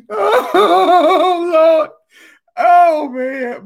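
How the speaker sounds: high, breathless laughing cries; laughing exclamations. Real, not acted. Vlogger recovering from a laughing fit, still laughing. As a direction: let the laughter spill into the words. After laughing till crying.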